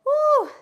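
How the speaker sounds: high, loud exertion "woo"; out-of-breath woo. Real, not acted. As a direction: let out a sharp high whoop of effort. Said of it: "I'm out of breath".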